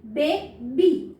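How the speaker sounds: बे, बी with a long "ay" in बे, split into two syllables. Shown as a demonstration. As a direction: say बे as open "bay", then बी. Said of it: "यहाँ पे मैंने बे पढ़ा है". (बे में लंबी ए की आवाज़, फिर बी)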